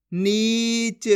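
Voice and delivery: नीच slow with a long ee; drawn-out vowel. Shown as a demonstration. Teacher reads the joined word, नीच drawn out. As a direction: stretch the ई in नीच. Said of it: "जिस तरीके से मैं खींच खींच के पढ़ रहा हूँ".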